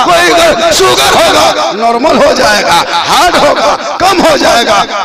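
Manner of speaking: shouted, high-pitched, slow emphatic recital; full-force preaching. Retold or imitated. Doctor answering the maulana on dawn's benefits, shouted as full-force preaching. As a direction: belt out each benefit to the crowd.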